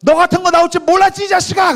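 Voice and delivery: very high, loud yelled comeback; mother's angry retort. Acted. Mother snaps back at daughter's attack, furious. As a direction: explode back at the kid. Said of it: "엄마가 뭐라 했냐면".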